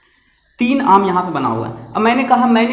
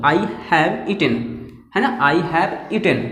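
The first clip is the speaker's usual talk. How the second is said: I have eaten धीरे और साफ़ बोलें; slow model sentence; 3 semitones lower, much slower than usual. Shown as a demonstration. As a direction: say I have eaten slowly for students to copy. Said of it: "best pronunciation होगा". (छात्रों के लिए धीरे-धीरे उदाहरण वाक्य)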